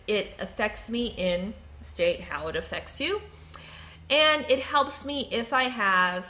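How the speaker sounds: usual speaking voice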